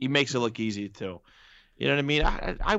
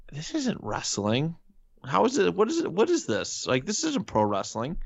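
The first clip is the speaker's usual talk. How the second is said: a fan ranting that this isn't wrestling; exasperated disbelief; 7 semitones higher than usual. Retold or imitated. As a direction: retell your outrage, pitching up in disbelief. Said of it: "And I'm just like".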